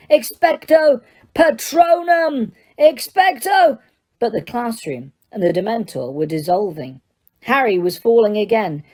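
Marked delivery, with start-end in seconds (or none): 0.1-3.8 high, slow, straining shout; desperate shouted spell. Acted. Harry fighting the Dementor, slow desperate shout. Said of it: "Harry yelled".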